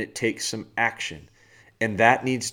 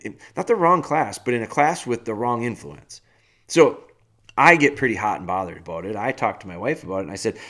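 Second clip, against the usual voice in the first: normal talking voice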